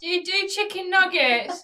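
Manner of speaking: put-on high, loud drunken demand. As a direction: badger the waitress like a drunk. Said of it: "So he's really drunk".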